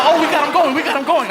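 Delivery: excited aside. Acted. Comedian to his friends about the laughing cop, excited.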